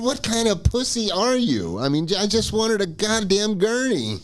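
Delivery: high-pitched exasperated retort; indignant rant. Retold or imitated. Dizzy patient mocking the orderly's fear, indignant. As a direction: throw it back at him, pitched high.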